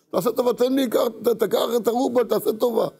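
high-pitched acted begging; urgent pleading. Husband begging buyer to take the ruble back, acted high pleading. Husband begs to reverse the deal.

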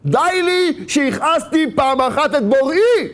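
high, drawn-out anguished cry. Acted. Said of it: "והוא צועק וצורח".

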